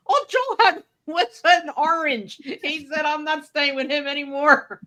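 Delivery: put-on Iranian-accented wrestler complaining about the host's fridge; Iron Sheik accent. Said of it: "with his little accent".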